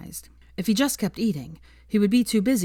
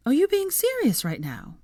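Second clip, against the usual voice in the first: high, incredulous question; disbelief, pitch shooting up; flabbergasted; 12 semitones higher than usual. A narrator's reading. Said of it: "she said, flabbergasted".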